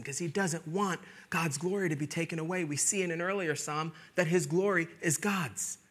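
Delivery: ordinary talk, nothing marked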